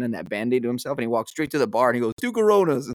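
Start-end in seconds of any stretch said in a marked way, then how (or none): 2.2-2.9 high, put-on triumphant call; called-out bar order. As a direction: sing out a mock drink order. Retold drunk man ordering at bar.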